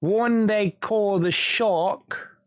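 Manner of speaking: British gangster. Acted. Intruder names the wanted Shark, slow British gangster accent. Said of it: "sounding like a British gangster".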